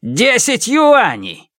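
high, drawn-out angry cry; slow, high-pitched shout. A narrator's reading. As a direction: fling it in his face. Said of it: "Он выкрикнул это Льян Сихаю в лицо".

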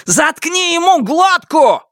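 high, drawn-out shout; desperate crazed scream. A narrator's reading. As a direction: scream it with your last strength. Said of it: "выкрик стоил Сяо Вону последних сил". (отчаянный вопль из последних сил)

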